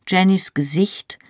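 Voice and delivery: usual speaking voice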